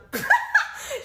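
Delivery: high squealing laugh; burst of laughter. Real, not acted. Laughing while retelling the rude girl. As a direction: cracks up mid-story.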